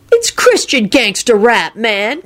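high, slow earnest dude voice; pious. A narrator's reading. Diner defends his music taste, pious. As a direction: correct him piously, drawing it out. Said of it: "Kenny informed him piously".

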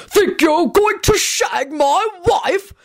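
high, slow bellow of outrage; high-pitched, drawn-out acted shout; outraged shout. A narrator's reading. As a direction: bellow it like an affronted husband. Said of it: "he shouted".

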